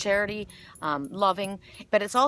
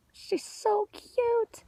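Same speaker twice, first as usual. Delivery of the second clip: high, slow, cooing voice; 14 semitones higher, much slower than usual. Real, not acted. Cooing at a little girl.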